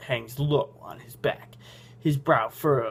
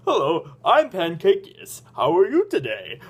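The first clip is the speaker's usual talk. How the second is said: Pancakeus; 3 semitones higher, 4 dB louder than usual. Shown as a demonstration. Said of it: "That's the way he sounds".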